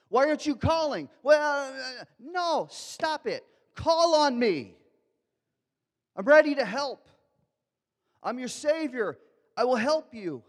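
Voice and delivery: high, loud full-force plea in God's voice; impassioned preaching. Acted. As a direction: cry out God's invitation to the hearers.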